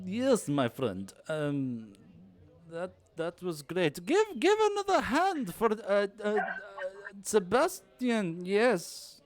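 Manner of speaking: put-on high, slow accented announcer voice; halting, high-pitched showman calling for applause; showman host. [name] to the crowd after the flop, put-on showman voice.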